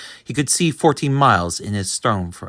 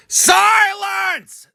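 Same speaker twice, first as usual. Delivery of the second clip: high, loud bark of a tyrant king; barked command; 9 dB louder than usual. A narrator's reading. Turtle king barks at Mac. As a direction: snap it like an angry turtle king. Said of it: "the king of the turtles barked back".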